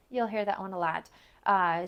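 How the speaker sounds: ordinary talk, nothing marked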